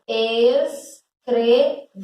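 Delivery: ES-CRE-VER in slow separate syllables; split into syllables. Shown as a demonstration. Teacher separating the syllables to count them, syllable by syllable. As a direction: break "escrever" into three drawn-out beats, es, cre, ver. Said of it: "quando eu separo a sílaba".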